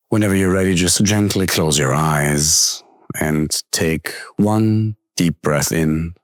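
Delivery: calm, unhurried meditation guide with long pauses; guided meditation. Acted. Coach leading listeners with closed eyes into breath awareness, calm meditation voice.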